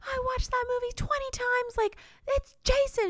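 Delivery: high, indignant imitated protest; mock insistence. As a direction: protest like the frantic girl. Victim insists on answer.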